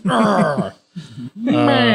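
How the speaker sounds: high-pitched mock cry of frustration; frustrated yell. Game master voicing a fighter whose axe missed, mock frustrated yell. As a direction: wail it in hammed-up frustration. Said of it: "yell out in frustration. He's just like".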